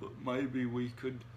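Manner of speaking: slow, hesitant troll voice; lumbering troll. A narrator's reading. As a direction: tentatively plead as a lumbering troll. Grumbles tries suggesting again.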